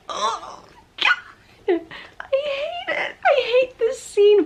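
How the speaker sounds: high, slow, laughing whine; laugh-cry through it; laughing distress. Real, not acted. Vlogger reliving a scary scene, high laughing whimper.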